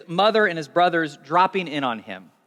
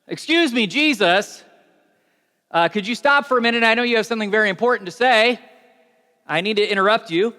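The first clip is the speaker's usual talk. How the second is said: higher, eager voice of someone breaking in; polite interruption; 4 semitones higher, 4 dB louder than usual. Acted. A person in the crowd interrupting Jesus, eager and apologetic. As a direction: cut in apologetically, a bit high.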